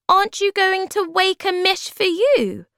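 very high, loud little voice; high-pitched storybook creature, curious; tiny creature Bob. Acted. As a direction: squeak the question up high. Tiny creature Bob asks the magician.

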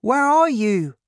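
put-on high, loud child searching for a parent; loud, high-pitched searching call. A narrator's reading. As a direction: call into the night in a high young voice. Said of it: "I called".

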